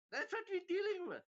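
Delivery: high, loud exasperated exclamation; exasperated outburst. Real, not acted. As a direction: let the voice leap up in disbelief. Exasperated verdict after mocking Truss.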